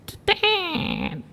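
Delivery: high, soft squeaky door noise; imitated door creak. Door opens for the visitor, imitated creak.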